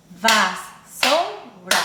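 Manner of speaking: "Vassoura" very slowly, three beats with gaps; syllable by syllable. Shown as a demonstration. Teacher counting three syllables, "Vassoura" syllable by syllable. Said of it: "três grupos de sons".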